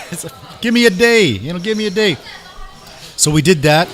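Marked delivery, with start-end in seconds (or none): 0.6-2.2 laughing plea. Retold or imitated.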